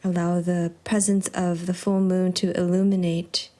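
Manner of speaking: soft, unhurried meditation guide with long pauses; hushed, calm yoga-teacher cueing; guided yoga practice. Real, not acted. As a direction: ease them softly into stillness.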